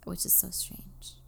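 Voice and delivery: unvoiced hushed aside; whispering. Real, not acted. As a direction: drop to a whisper. Trails off to a whisper.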